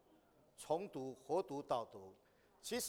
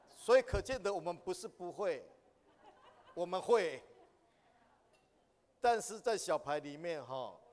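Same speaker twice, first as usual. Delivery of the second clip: ordinary talk, nothing marked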